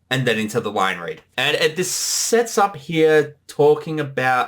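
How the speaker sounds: ordinary talk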